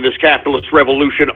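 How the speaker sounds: ordinary talk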